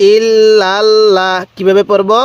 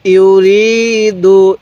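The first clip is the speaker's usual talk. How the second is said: slow recitation; much slower than usual. Shown as a demonstration. Teacher demonstrating the word for repetition. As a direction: stretch every sound evenly. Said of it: "এরকমভাবে শিখব।".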